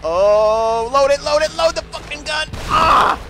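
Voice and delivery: rising, high, loud frantic urging; panicked yelling. Real, not acted. Player urging the gun to load, panicked yelling. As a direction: yell it in mounting panic, ending in a scream. Frantic reload under attack.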